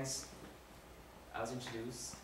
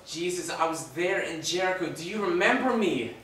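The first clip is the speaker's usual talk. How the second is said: raised, loud plea, building in urgency; pleading call; 4 semitones higher, 8 dB louder than usual. Acted. Dying robber calling to Jesus from his cross, pleading loudly. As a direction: call out desperately to be recognized.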